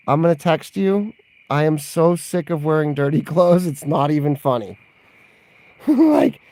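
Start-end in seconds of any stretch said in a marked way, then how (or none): 4.8-6.4 high laugh breaking into the word; giggly like. Real, not acted. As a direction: laugh it out, higher. Laughing at own story.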